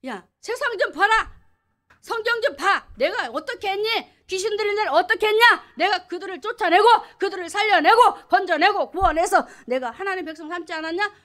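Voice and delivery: God scolding a doubting believer; God rebuking. Acted. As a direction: challenge them like a stern father. Preacher voices God rebuking.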